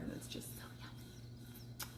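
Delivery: breathy whisper of delight. Real, not acted. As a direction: whisper it like a secret treat. Eating tangerine, savoring it.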